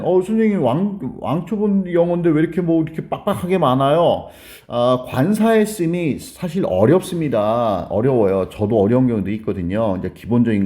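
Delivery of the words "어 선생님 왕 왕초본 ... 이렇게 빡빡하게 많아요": higher-pitched student's protest to the teacher; complaining student. Acted. Beginner complaining to teacher about crowded material, high-pitched protest. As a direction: whine up at the teacher like an overwhelmed beginner.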